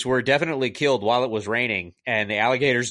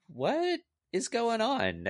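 high, slow, soft bewilderment; exaggerated bafflement; 11 semitones higher, 7 dB quieter, much slower than usual. Reviewer baffled by a silly alligator movie, exaggerated bafflement. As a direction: draw out the baffled question.

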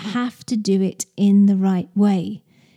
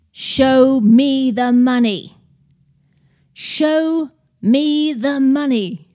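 slow, drawn-out emphatic catchphrase; big, deliberate movie-line delivery; emphatic demand; 3 semitones higher, 4 dB louder, slower than usual. Acted. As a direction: stretch each word out, punching it hard. Acting out Rod's famous film demand.

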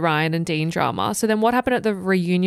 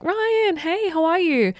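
high-pitched, friendly hello; eager greeting; 10 semitones higher, slower than usual. Retold or imitated. Acted friendly greeting to Ryan.

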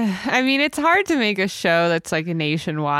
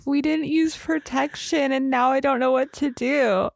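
high, hammed-up weepy retelling; imitating a fake-crying girl, voice pitched up; mock tearful [name]; 7 semitones higher than usual. [name] fakes tears to gossip Abby, mock weepy high voice. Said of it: "And she's like".

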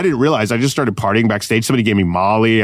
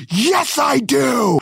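high-pitched mock shout; retold defiance, voice flung way up; defiant yell; 14 semitones higher, much slower than usual. Comedian answering guard's challenge, defiant yell.

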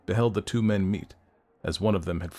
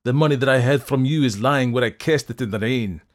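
loud, raised scornful refusal; indignant cry; 5 semitones higher, 7 dB louder than usual. A narrator's reading. Fettes refusing the doctor's money, indignant cry. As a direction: draw out the first words in outrage. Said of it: "cried Fettes".

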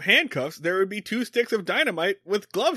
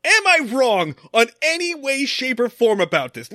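louder, pressing questions full of anger; angry podcaster demanding agreement, louder; furious rant; 3 semitones higher, 6 dB louder than usual. Real, not acted. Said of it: "this episode makes me fucking furious".

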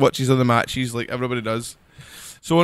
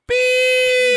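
high mock bleep, a touch louder; squeaky beep noise; 3 dB louder than usual. Joking to friends, a squeaky mock bleep. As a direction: pipe out a high censor beep.

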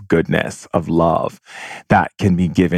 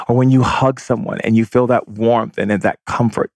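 usual speaking voice

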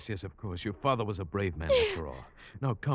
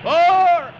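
full-throated yell against the wind; shouted call; 12 dB louder than usual. Acted. Skipper on the bow yelling to Halvard, loud. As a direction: bellow it into the storm.

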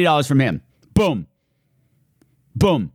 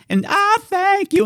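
hyped up; slower than usual. Real, not acted. Grateful donor thanks.

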